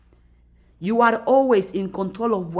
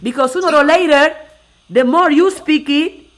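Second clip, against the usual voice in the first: louder and higher, building urge; rallying; 5 semitones higher, 8 dB louder than usual. Real, not acted. Urging viewers to keep speaking positive, louder rallying call. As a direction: push it like a coach. Motivational climax.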